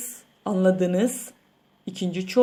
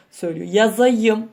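ordinary talk

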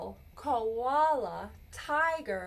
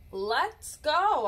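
higher, louder coaxing; bright urging call; 6 semitones higher, 6 dB louder than usual. Acted. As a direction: coax them to come along. Parent urging child.

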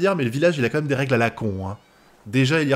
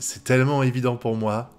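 ordinary talk

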